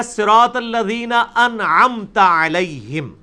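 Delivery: slow, careful recitation of انعمت with the final ت on a short a; correct Arabic recitation. Shown as a demonstration. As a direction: recite slowly, every vowel clear. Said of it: "اس کی میں آپ کو نون example دیتا ہوں".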